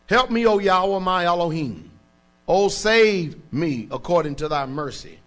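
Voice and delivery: slow, loud psalm reading from the pulpit; solemn, slowed scripture recitation. Acted. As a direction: read it out like a cry for help.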